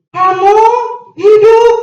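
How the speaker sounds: loud, high, word by word; proclaimed. Real, not acted. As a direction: proclaim each word to the hall. Preacher proclaims emphatically. (diserukan keras kata demi kata)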